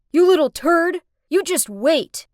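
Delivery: shouted threat. Acted. The fleeing boys shouting back at Kurt, loudly. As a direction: yell a threat while running away. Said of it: "they turned around and shouted".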